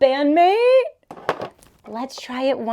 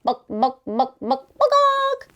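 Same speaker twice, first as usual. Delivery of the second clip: clucking like a hen; chicken clucking; 4 dB louder than usual. Pretend. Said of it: "goes the chicken".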